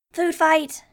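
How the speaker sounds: loud, high, gleeful shout; happy cry. A narrator's reading. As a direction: yell it out with pure delight. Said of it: "he cried happily".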